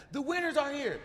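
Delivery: high, loud announcement; announcing shout. Retold or imitated. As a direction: call it out to the whole gate. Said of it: "She's like".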